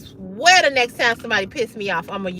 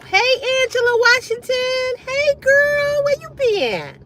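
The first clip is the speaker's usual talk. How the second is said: excited greeting; 13 semitones higher than usual. Real, not acted. Greeting a returning viewer.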